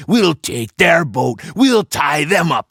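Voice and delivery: roaring. A narrator's reading. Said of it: "he roared".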